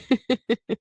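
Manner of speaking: a high, moved little laugh; teary laugh. Real, not acted. Grateful laugh, teary eyes.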